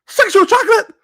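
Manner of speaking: high, loud shout of recognition; mock delighted cop; excited shout. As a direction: yell the name like a star-struck fan.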